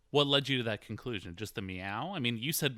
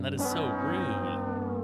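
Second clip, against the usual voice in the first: higher, slow, drawn-out scandalized gasp of a line; co-host hamming up shock at eating a date's food; mock outrage; 8 semitones higher, 4 dB louder, much slower than usual. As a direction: clutch the pearls over the rudeness.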